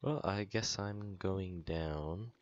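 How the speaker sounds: very slow reluctant drawl; slow resigned. Real, not acted. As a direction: resign yourself slowly.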